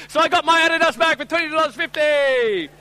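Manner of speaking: loud, high victory shout; triumphant announcement. Real, not acted. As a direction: crow about the bargain you won. Crowing over the bargain won.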